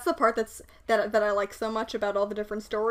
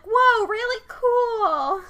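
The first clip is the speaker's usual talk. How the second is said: very slow, high, loud mock swoon; 12 semitones higher, 5 dB louder, much slower than usual. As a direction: swoon it out dreamily.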